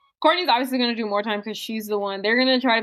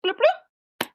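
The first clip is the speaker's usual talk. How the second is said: high, chirpy imitated shutter sound; camera click noise. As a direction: mimic a Polaroid snapping.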